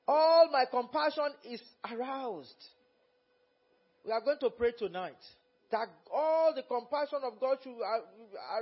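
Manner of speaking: loud, fervent preaching, quoting God. Acted. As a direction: preach it out to the congregation. Preacher at full force.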